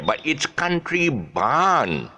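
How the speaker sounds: Barn with a slow, drawn-out long ah; long vowel in Barn. Shown as a demonstration. Teacher showing the class how to say Barn slowly. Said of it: "You have to say the long sound".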